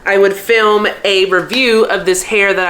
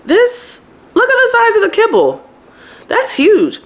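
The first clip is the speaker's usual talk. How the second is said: amazed, much higher voice; high-pitched amazement; 13 semitones higher than usual. Real, not acted. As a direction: squeal over the kibble size. Amazed at huge kibble.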